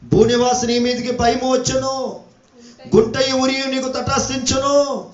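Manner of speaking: sustained, ringing prophetic proclamation; preaching. Acted. Preacher warning earth's inhabitants from scripture, full preaching voice. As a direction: proclaim it like a warning, stretching the endings.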